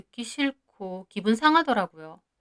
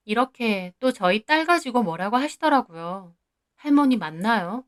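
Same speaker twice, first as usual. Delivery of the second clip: ordinary talk, nothing marked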